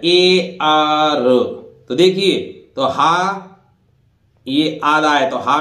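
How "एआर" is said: एआर drawn out slowly as one sound; drawn-out pronunciation. Shown as a demonstration. Teacher demonstrates एआर sound slowly. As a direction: stretch एआर slowly like air. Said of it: "इन दोनों का उच्चारण एयर जैसा होता है".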